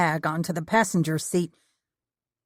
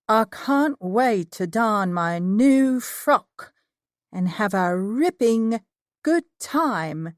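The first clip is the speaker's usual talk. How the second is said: slow, higher, badly faked British accent; terrible British accent; 5 semitones higher, slower than usual. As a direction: ham up a clumsy British accent, slowly. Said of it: "I said in my best British accent, which was terrible".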